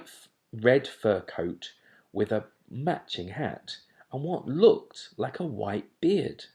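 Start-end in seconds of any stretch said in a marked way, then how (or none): none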